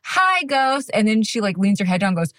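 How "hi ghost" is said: put-on high child's greeting; sweet high little-girl hello; kid greeting the ghost. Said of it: "And she goes".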